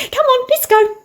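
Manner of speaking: high sing-out to follow along; high-pitched, chivvying invitation; high beckoning call. Real, not acted. Beckons viewers along to the garden.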